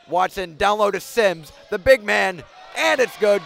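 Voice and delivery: loud, rising call of the score; excited basket call. Real, not acted. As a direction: punch it as the shot drops.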